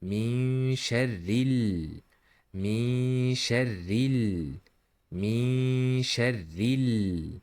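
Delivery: "من شر ال" with the final l sustained; held lam. Shown as a demonstration. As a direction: linger on the l after a hummed "min sharr". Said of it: "Pay attention to the holding the lam".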